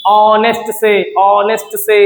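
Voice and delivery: slow ऑनेस्ट, first vowel held long; stretched first sound. Shown as a demonstration. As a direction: draw out the opening vowel of ऑनेस्ट. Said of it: "शुरुआत कहाँ से की हमने बोलते समय?". (ऑनेस्ट को धीरे, स्वर खींचकर)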